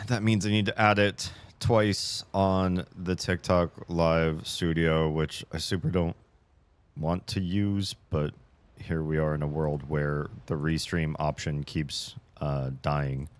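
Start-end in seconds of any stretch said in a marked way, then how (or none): none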